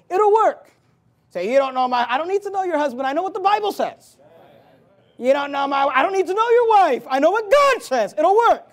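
high, loud insistent preacher; forceful preaching. Acted. Preacher answering objecting husbands and wives, forceful preaching.

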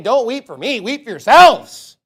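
preacher booming weep for yourselves; loud warning. Acted. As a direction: warn them with force.

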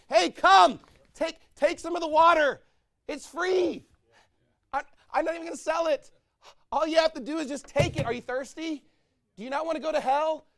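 high, loud pleading invitation, quick in places; soul winner calling out. Acted. Soul winner to the lost, high loud pleading invitation. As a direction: beg the lost to come and drink.

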